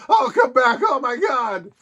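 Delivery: the fretting friend calling after the rocket; worried yell. Retold or imitated. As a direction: blurt it out in a fretful panic. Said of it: "He's like".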